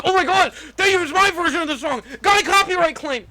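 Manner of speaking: high, loud mock outrage; outraged YouTuber. Imitating a YouTuber claiming copyright on a song, shrill outrage. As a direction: shriek like an indignant YouTuber.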